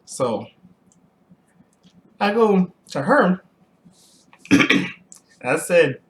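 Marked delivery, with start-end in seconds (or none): none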